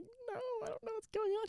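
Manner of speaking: mock whimper, high and small. Sick girl answering worried teammate, pitiful whimper. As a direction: imitate a pitiful, soft, high-pitched whine.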